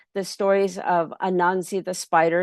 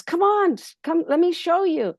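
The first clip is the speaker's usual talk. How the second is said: urgent pleading; 10 semitones higher than usual. Acted. Pedro hurrying the boss.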